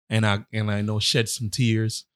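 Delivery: ordinary talk, nothing marked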